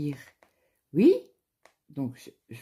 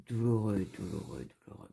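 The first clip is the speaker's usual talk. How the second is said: each douloureux quieter, the last one only breathed; fading away; 4 semitones lower, 5 dB quieter than usual. Retold or imitated. Pain growing, word repeated fading.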